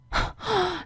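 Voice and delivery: short, incredulous laugh; bitter laugh. Acted. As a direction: let out a bitter, disbelieving laugh. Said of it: "실소가 터지더라고요". (어이없어 터지는 실소)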